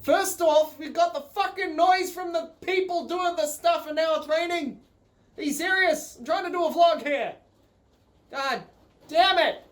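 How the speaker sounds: high, loud frustrated outburst at the noise; exasperated rant. Real, not acted. Vlogger fed up with rain and noise, loud exasperated rant. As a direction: vent the annoyance, pitch up and loud.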